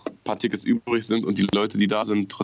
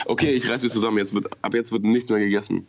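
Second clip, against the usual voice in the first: ordinary delivery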